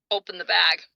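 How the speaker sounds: ordinary delivery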